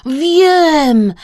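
loud, high exclamation; excited call. A narrator's reading. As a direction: call out a sudden bright idea. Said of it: "zawołał Kresek".

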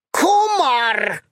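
high squealing shout of alarm; piglet's yell. A narrator's reading. Stung piglet yelling at the mosquito, high shout. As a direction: yell it out the moment the sting lands. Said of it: "wrzasnął".

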